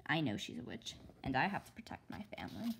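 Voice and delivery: fast, hushed secret vow; urgent whisper. A narrator's reading. Girl vowing to protect her family, whispered. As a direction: whisper it quickly, determined.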